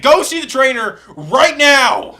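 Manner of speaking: a coach's loud, slow insistence; firm order. Retold or imitated. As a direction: lay down the order with no room to argue. Coach ordering him to trainer.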